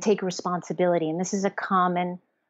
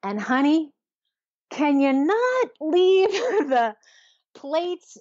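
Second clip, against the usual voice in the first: higher, drawn-out mock exasperation; playful spousal complaint; 7 semitones higher, 4 dB louder, slower than usual. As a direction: plead with your partner, half laughing. Acting a spouse's complaint about plates.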